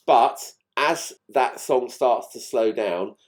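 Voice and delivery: ordinary talk, nothing marked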